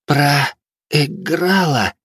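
slow, high, rasping cry; hoarse croak. Acted. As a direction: rasp it out in defeat. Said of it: "прохрипела".

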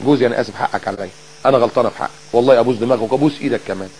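soft, low pleading for pardon; humble apology. Acted. Wife apologising to her husband, soft and humble. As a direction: play a wife humbly giving in. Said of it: "متكبرة ليه انك انت تروح له".

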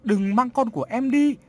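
high, loud desperate wail; frantic weeping mother's plea; bursting into tears. A narrator's reading. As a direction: cry out begging, pitch way up. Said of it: "khóc òa lên".

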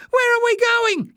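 very high, loud squeal; high-pitched alarm; panicked cry. A narrator's reading. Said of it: "he cried in panic".

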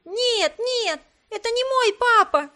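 put-on high, loud child's protest; crying-out child. A narrator's reading. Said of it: "закричал ребёнок".

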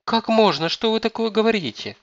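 very high, scandalized protest; shocked daughter-in-law, pitched right up; appalled. A narrator's reading. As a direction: cry out aghast at the outrage. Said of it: "ахнула невестка".

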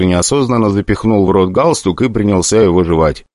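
usual speaking voice

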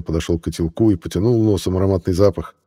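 normal talking voice

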